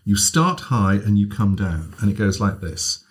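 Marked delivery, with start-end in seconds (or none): none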